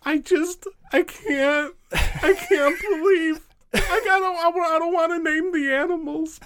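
high, tearful fake breakdown; mock crying. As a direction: play it like a hammy sob. Mock tearful interview about blood.

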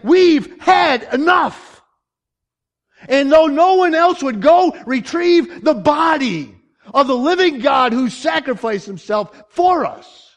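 high, loud, slow sermon climax; full-force preaching. Real, not acted. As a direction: drive each phrase home like an impassioned preacher.

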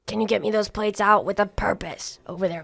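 loud, bossy call across the kitchen; barked kitchen order. Acted. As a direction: holler at the cook to hurry. Boss orders cook to hurry.

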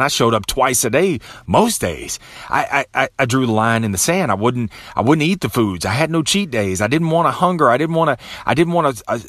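ordinary talk, nothing marked

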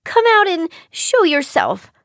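put-on high, demanding girl; high-pitched challenge to an unseen prankster. A narrator's reading. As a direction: demand it, suspecting a trick. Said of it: "Chiyo demanded".

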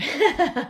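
short nervous laugh, high and loud; a real embarrassed little laugh breaking out. Real, not acted. Nervous laugh mid confession.

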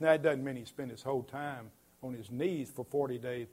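usual speaking voice

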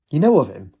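bright, rising to a high peak; light up with joy; delighted. A narrator's reading. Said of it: "Katie's father said with delight".

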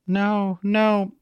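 trance-like. A narrator's reading. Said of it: "Truth said in her trance-like voice".

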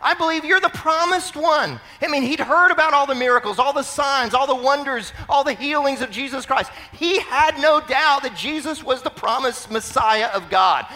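high, loud, fervent declaration; full-force preaching. Acted. Preacher declaring the beggar's faith in the Messiah, fervently. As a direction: proclaim it with total conviction.

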